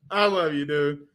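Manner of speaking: normal talking voice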